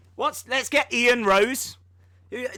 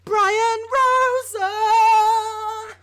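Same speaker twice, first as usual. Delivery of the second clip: loud, slow, high cry; mock mantra scream; 9 semitones higher, 5 dB louder, much slower than usual. Streamer imitates screaming his gym mantra while lifting.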